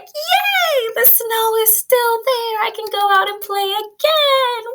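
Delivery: high, bright-eyed whoop of delight; joyful cheer. Acted. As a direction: cheer like a kid who sees fresh snow. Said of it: "He's like".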